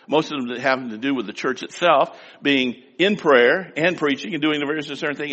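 usual speaking voice

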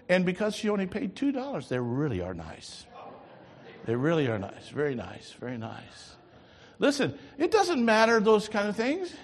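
ordinary delivery

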